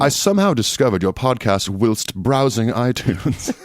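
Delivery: slow, hammed-up formal character voice; Turner Duckworth. Host reads a listener's email in character, slow hammed-up delivery. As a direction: stretch it out theatrically, slowly. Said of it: "Turner Duckworth reading this".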